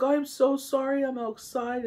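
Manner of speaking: high, hurried-to-apologize quote of someone else; high-pitched apology. Retold or imitated. Woman excusing herself for being outside, high apologetic voice.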